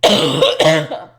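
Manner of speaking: loud hacking cough; real coughing fit. Real, not acted. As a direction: cough hard, choking on it. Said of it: "choking on my own".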